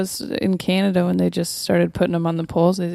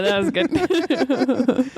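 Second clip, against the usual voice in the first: slow drawn praise; 5 semitones higher, much slower than usual. Acted.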